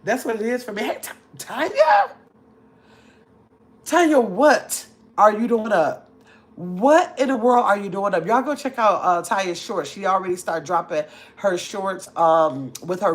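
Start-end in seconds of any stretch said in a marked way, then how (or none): 0.8-8.3 high, drawn-out surprised greeting; astonished, slow and emphatic. Real, not acted. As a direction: call out in loud disbelief. Spots a friend up late.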